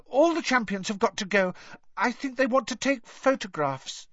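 put-on high, bright eager boy voice; a keen little kid asking politely; eager young boy. A narrator's reading. Colin voiced by narrator.

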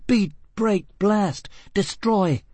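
furious tirade. A narrator's reading. Gisbella raging at Foyle.

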